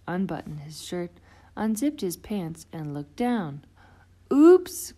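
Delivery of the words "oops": high, loud startled oops; a shocked squeak of realization; sudden alarmed cry of oops. Acted. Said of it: "cried Froggy".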